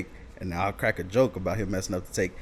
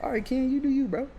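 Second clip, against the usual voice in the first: resigned. Retold or imitated. Resigned reply to friend.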